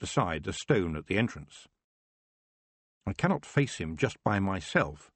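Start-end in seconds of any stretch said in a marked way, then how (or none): none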